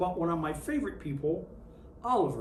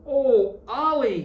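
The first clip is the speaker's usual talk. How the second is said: loud, higher exclamation of dismay; sister crying out; 6 semitones higher, 10 dB louder, slower than usual. A narrator's reading. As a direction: cry out his name as he lands in the mess. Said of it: "his sister cried".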